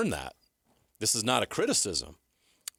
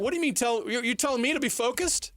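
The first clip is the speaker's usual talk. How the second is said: much higher, incredulous player voice; mock player pushback; 13 semitones higher than usual. Players protesting to their coach, mock incredulous and high. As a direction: play a player calling out the coach.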